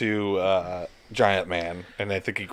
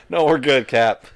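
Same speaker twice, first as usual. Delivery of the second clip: breezy brush-off; 5 semitones higher, 8 dB louder than usual. Retold or imitated. Dismissive acted quote of heroes.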